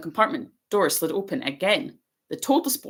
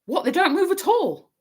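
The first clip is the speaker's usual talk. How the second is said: high, louder amazed question; 14 semitones higher, 4 dB louder than usual. A narrator's reading. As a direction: sound truly astonished. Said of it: "Ron sounded amazed".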